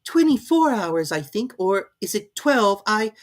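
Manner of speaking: put-on high, pleading girl's voice; a polite girl asking; young Alice. A narrator's reading. Alice figure voice.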